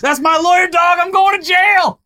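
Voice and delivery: high frantic yelling; panicked shout. Acted. Voicing Rudy panicking.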